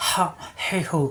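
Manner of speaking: হা, হে, হু squeezed from mid-throat; throat pressure. Shown as a demonstration. Teacher demonstrates Arabic letter, হা, হে, হু pressed from the throat. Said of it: "কণ্ঠনালীর মাঝখানে চাপ সৃষ্টি হবে".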